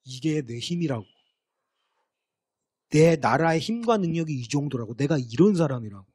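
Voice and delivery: mock the king bragging of his own might; arrogant boasting. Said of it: "근데 어떻게 자랑했냐면". (거만하게 자기 힘을 뽐내는 말투)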